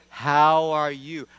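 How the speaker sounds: loud, very slow, drawn-out greeting; exaggerated repeat. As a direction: spell out "How are you?" loudly and slowly as if to someone who didn't hear. Repeats greeting to unhearing cashier.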